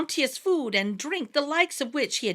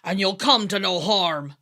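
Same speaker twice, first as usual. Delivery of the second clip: mock fierce; 3 semitones lower, 5 dB louder than usual. A narrator's reading. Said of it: "trying to be fierce".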